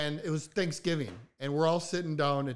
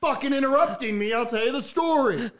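loud, high protest at being interrupted; fed-up shout over the interrupters; exasperated; 9 semitones higher, 5 dB louder than usual. Real, not acted. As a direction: raise your voice to take the story back. Cut off mid-story, snapping at interrupters.

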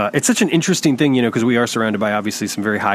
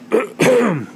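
sharp high throat clear, coughing it up; throat clearing after a bug; 12 semitones higher than usual. Real, not acted. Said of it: "I think I just swallowed a bug".

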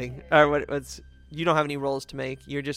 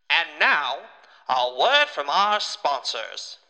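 announcer voice; 7 dB louder, much slower than usual. Acted. Announcing the sponsor break.

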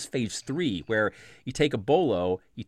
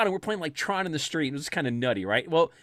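normal talking voice

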